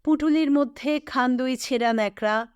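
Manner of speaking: usual speaking voice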